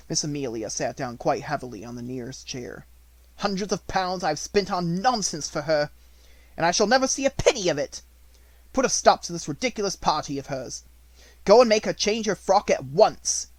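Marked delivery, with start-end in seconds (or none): 3.4-13.5 louder, higher angry rant; seething over wasted money; indignant tirade. A narrator's reading. Headmistress ranting at her sister, angry and louder. Minchin rants and orders.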